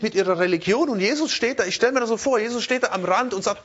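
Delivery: ordinary delivery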